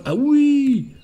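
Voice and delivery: high, loud, stretched cry of delight; thrilled discovery, pitch leaping up; real delighted excitement. Real, not acted. Delighted discovery of first-person aiming.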